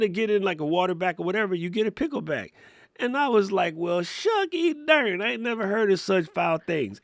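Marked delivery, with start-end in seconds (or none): 3.7-7.0 high, hammed-up Texas twang; corny Texan, exaggerated and high; terrible Texas accent. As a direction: put on a bad Texas accent, pitched way up. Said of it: "that was the most terrible Texas accent".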